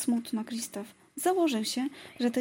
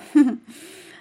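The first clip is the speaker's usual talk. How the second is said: Anna laughing at her sister's teasing; loud laugh; 2 semitones higher, 13 dB louder than usual. A narrator's reading. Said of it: "Anna ze śmiechem".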